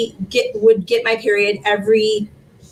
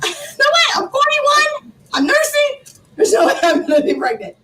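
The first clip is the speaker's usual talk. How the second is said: much higher, incredulous protest; stunned disbelief; 11 semitones higher than usual. Retold or imitated. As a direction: refuse to believe it, voice up high. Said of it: "I'm like".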